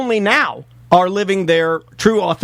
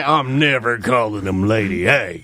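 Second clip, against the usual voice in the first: low, slow grumbling voice; stubborn grump; 11 semitones lower, slower than usual. Retold or imitated. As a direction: play a stubborn old grouch. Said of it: "some people that say".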